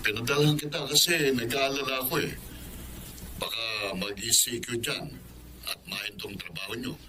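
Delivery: faint, distant voice through a phone line; over the phone. Acted. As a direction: sound like you're on the far end of a call. Uncle speaking over the phone.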